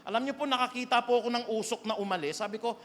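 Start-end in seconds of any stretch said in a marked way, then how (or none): none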